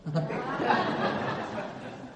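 an airy, voiceless laugh; breathless laugh. Real, not acted. Speaker to the audience, laughing softly at the excuse.

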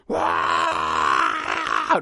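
low shouted animal roar; primal animal scream. Pretend. A man in raw pain, low shouted roar. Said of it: "como de animal".

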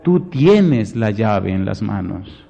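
ordinary talk

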